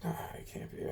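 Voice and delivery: breathy whisper, almost no voice; whispered doubt. Retold or imitated. Vlogger recalling his own disbelief, in a whisper. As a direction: hush it like a thought to yourself.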